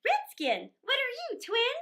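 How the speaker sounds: high, loud eager call; boy shouting with glee; excited. Acted. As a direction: pass the question on eagerly. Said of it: "And Nibs said".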